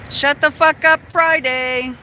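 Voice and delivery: loud, high angry yell at the dog. Real, not acted. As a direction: bark the order at the dog to shut up. Yelling at the dog.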